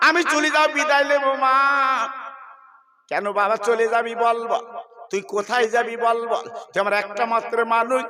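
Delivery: loud, soaring sermon chant, high and ringing; full-force preaching. Acted. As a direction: preach it out to the whole crowd.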